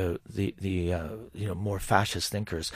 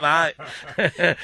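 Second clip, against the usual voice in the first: hammy Cockney geezer voice; laughing through a Cockney bloke; Cockney accent; 4 semitones higher, 5 dB louder, much slower than usual. Pretend. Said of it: "Do your Cockney accent again".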